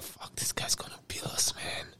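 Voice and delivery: hushed, voiceless aside; whispered dread. Retold or imitated. Passenger realising the officer will bill them, whispering. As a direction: whisper it as a worried private thought.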